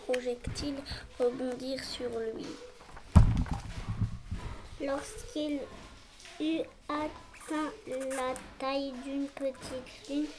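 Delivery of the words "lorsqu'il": ordinary delivery, nothing marked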